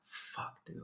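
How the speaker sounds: breathy whispered curse under the breath. Retold or imitated. Hiker cursing to himself about the stranger, whispered. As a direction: mutter it unvoiced so he won't hear. Stranger won't pass, inner reaction.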